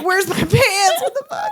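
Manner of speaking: high-pitched, drawn-out panicked yelp; hammed-up freaked-out teenager; mock panic. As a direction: squeal it in comic alarm. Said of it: "And he's like".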